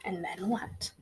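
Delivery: soft unvoiced whisper. Real, not acted. Teacher whispering a question to the children. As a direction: ask it in a whisper. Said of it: "whispered question".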